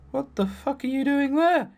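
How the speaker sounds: shocked gamer reaction; startled. Real, not acted. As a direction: caught off guard, blurting it out. Startled by a game character.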